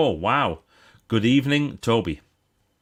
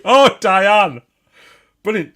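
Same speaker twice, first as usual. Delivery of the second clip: delighted; 11 semitones higher, 12 dB louder, slower than usual. Real, not acted. Delighted by the viewer's poem.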